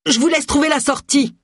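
high-pitched call over the shoulder; cri lancé. A narrator's reading. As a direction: call it out high, as if already leaving. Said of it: "criant par-dessus son épaule". (cri aigu lancé par-dessus l'épaule)

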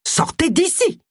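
high-pitched angry order; roaring. Acted. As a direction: throw them out in outrage. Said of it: "rugit Garrett".

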